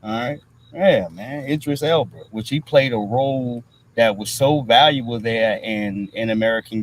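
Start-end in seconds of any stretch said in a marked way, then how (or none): none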